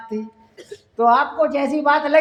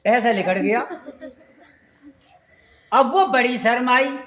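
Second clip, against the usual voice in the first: ordinary talk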